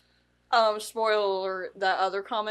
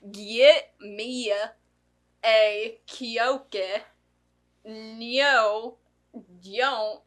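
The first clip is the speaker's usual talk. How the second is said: imitating a movie mama's speech impediment; slow, halting words with an odd drawn-out twang; mock the garbled speech, one labored word at a time; mama's impeded speech; much slower than usual. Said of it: "That's how she spoke".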